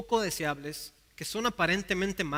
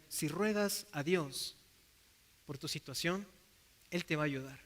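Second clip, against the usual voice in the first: gentle reassurance; 4 semitones lower, 6 dB quieter than usual. Acted. Advice to someone who suffers.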